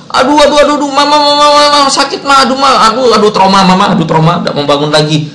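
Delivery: high wailing toddler, hammed up; mock child crying. Fallen toddler cries to mama, mock wailing that winds down. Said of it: "terus nangis".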